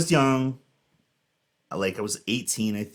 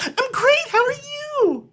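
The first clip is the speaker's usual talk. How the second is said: extremely high, loud, drawn-out phony reply; over-the-top fake friendly; 5 dB louder, slower than usual. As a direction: top their fake greeting with even more gush. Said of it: "a tweak above whatever they're giving me".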